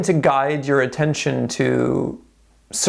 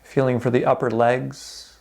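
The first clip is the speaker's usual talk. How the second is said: calm, unhurried meditation guide with long pauses; guided meditation. Real, not acted. Instructor guiding listeners through a body scan, calm guided meditation. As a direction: ease them gently through a body scan, leaving space between phrases.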